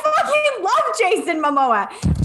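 high, giddy laughing gush; laughing excitement. Real, not acted. Cohost gushing over her celebrity crush, laughing high. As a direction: squeal it through laughter, slowly. Excited about Jason Momoa.

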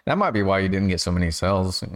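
usual speaking voice

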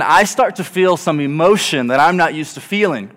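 ordinary talk, nothing marked

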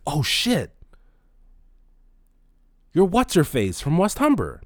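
high, slow dawning surprise; remembering her all at once, surprised and high; sudden realization. Retold or imitated. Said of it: "a fucking epiphany".

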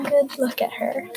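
plain reading voice

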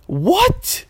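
high, startled what; startled at worms from the cat; shocked what. Retold or imitated. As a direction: gasp it out in disbelief.

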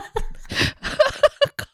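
airy high laugh; breathy laugh. Real, not acted. Laughing at colleague story.

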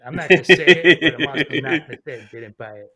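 loud, laughing through it. Real, not acted. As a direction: laugh out the line. Laughing while quoting himself.

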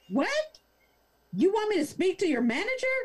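mock Karen bewildered squeak; shocked disbelief. Karen baffled by reply.

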